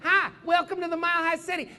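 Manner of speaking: recorded Southern-accented welcome, mimicked. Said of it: "nobody speaks with a Southern accent".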